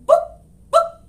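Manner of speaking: very high, loud clucking; clucking hen. Acted. Hen calling up the ladder to the librarian, clucking. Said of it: "clucked the hen".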